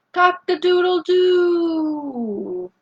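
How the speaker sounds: lighter rooster crow. Shown as a demonstration. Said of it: "when they say".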